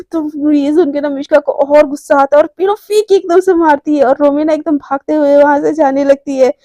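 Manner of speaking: plain reading voice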